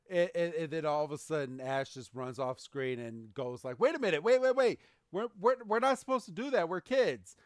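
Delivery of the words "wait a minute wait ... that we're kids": high, fast panicked protest; alarmed kid. Acted. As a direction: blurt it out higher and faster in alarm. Ash panics at the polluters.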